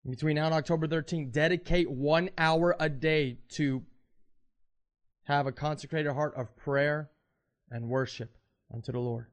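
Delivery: loud fervent preacher challenge; preaching at full force. Acted. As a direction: drive the challenge home from the pulpit.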